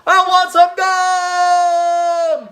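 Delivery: high, very slow, loud whining shout; whiny tantrum. Acted. Child throwing a tantrum at the register, whining shout. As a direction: wail it like a toddler throwing a fit. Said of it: "looked and sounded like this".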